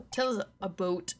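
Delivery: Canadian "about", said like "aboot"; lightly put-on Canadian accent on "about". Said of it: "forgot he's Canadian".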